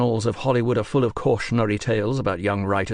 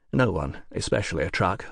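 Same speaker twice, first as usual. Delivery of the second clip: ordinary delivery, nothing marked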